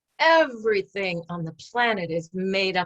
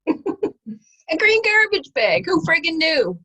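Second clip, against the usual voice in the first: laughing; 8 semitones higher, 8 dB louder, slower than usual. Real, not acted. Laughing at her own example.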